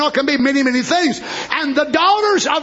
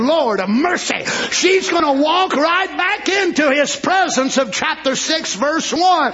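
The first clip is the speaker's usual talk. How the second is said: ordinary talk, nothing marked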